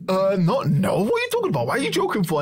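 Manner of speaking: high, panicked mock protest; flustered denial. A boy caught out in church, flustered denial.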